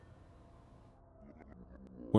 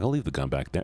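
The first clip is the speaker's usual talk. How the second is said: ordinary talk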